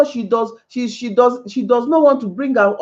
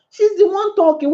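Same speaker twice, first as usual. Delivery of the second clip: ordinary talk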